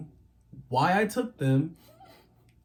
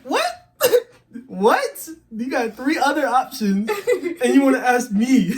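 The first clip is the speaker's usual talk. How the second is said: much higher and louder, incredulous; outraged disbelief; 10 semitones higher, 7 dB louder than usual. Retold or imitated. Son picked to pray right after a whipping, incredulous. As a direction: protest like a kid who can't believe it. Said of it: "It's like".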